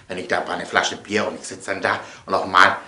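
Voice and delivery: usual speaking voice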